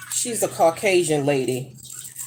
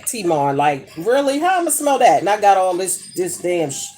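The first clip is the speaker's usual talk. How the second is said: ordinary talk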